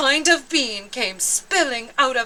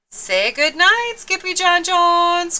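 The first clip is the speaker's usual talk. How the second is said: calling mama; 8 semitones higher than usual. Acted. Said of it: "called his mama".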